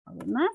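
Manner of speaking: «Además» con la última sílaba acentuada y ascendente; acento final. Shown as a demonstration. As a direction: sube en el «más» de «Además». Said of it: "el acento está en la última sílaba". («Además» con acento agudo al final)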